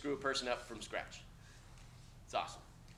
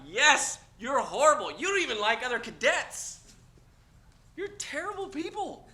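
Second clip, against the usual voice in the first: mock scolding; 10 semitones higher, 7 dB louder than usual. Real, not acted. Teacher scolds class, loud.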